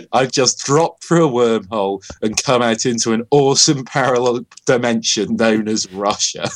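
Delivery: ordinary talk, nothing marked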